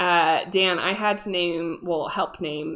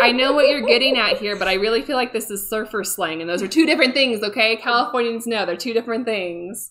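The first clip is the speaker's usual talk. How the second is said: retold argument; 4 semitones higher, 7 dB louder than usual. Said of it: "I had to argue with them".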